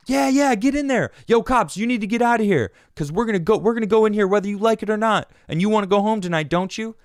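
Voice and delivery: high, loud mock taunt at the cops; taunting instigator. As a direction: goad the police like a rowdy agitator.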